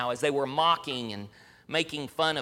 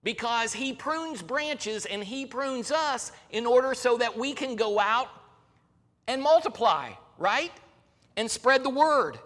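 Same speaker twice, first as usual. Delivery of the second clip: raised, high-pitched preaching voice; fervent preaching; 7 semitones higher than usual. Acted. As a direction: preach it out to the congregation.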